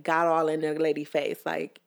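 normal talking voice

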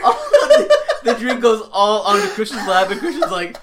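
high and loud, laughing through the words; can't stop laughing while telling it; cracking up mid-sentence; laughing hard. Real, not acted. Cracking up at mishap.

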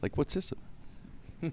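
hushed, slow, cautious probing; concerned. Retold or imitated. Wife questioning husband about the doctor visit, hushed concern. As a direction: imitate a worried wife, softly and slowly.

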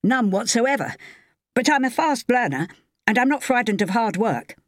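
keen newcomer's high voice; eager young man. A narrator's reading.

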